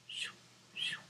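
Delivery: usual speaking voice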